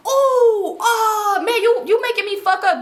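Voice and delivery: high, loud complaint as the player; mock the player losing his roll; lightly voiced irritated street dude; annoyed dice player.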